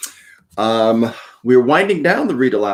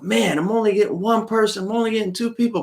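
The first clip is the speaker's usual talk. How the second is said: retold self-talk; 11 semitones higher, faster than usual. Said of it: "I would say to myself".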